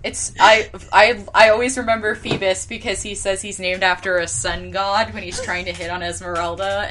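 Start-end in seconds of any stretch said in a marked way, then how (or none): none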